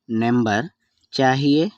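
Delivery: Number, a clear pause, then rising చాహియే; word by word. Shown as a demonstration. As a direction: separate number and చాహియే for learners. Teacher repeats phrase word by word. (పదం పదంగా, మధ్యలో ఆగి)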